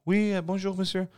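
high, lilting French bonjour; French greeting. Retold or imitated. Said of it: "So she goes".

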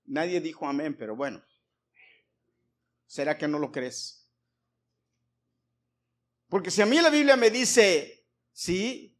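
normal talking voice

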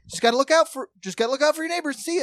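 breezy friendly neighbor calling out; cheery neighbor. Retold or imitated. Neighbor voiced leaving.

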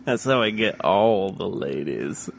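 low creaky fry, slow; vocal fry. Shown as a demonstration. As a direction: drop into a gravel-bottom fry. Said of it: "I've been testing out my vocal fry".

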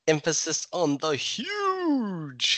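"huge" drawn way out, high then falling; milk the word "huge" slowly, mimicking his emphasis; exaggerated stress on huge. Playfully echoing the host's stressed word.

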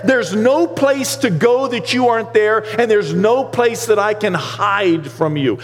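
high, rising pulpit voice, slowing on key lines; full-force preaching. Acted. Pastor preaching Psalm 139 to his church, full force. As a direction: preach it out to the congregation.

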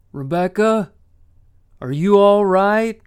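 loud call toward the house. Acted. Levi calling to Rebecca inside the house, loud call. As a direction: shout across the yard to her.

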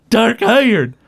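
high, insistent counter; mock-indignant retort; arguing. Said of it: "they both start arguing".

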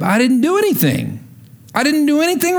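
put-on a kid insisting he did nothing wrong, pitch climbing; protesting kid. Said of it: "I looked at him and I said".

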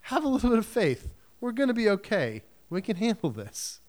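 short, separate phrases to calm a group; acted cutting through. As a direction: cut through the panic and settle them. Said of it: "just cut through and go".

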